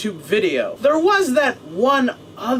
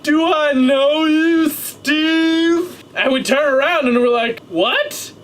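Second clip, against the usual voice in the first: put-on drunk guy voice; 5 semitones higher than usual. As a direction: ham up the tipsy guy. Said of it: "He's like".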